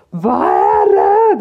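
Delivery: high, loud, drawn-out yell; psycho outburst. Retold or imitated. Mum snaps back at the yelling dog owner, psycho outburst. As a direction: re-enact losing it completely. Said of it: "som ett jävla psykfall".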